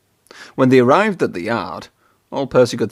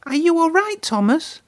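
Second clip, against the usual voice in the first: high, gentle concern; worried caring engine; concerned Percy. A narrator's reading. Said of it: "asked Percy".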